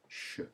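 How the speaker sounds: "sh" alone, an isolated soft consonant; sh sound. Shown as a demonstration. Teacher demonstrates the French ch spelling, sh sound. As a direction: sound out "sh" by itself, very quietly. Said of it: "we have in French the sh sound".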